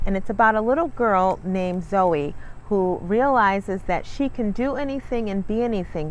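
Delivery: plain reading voice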